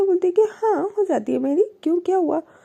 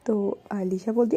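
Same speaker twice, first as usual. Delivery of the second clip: ordinary talk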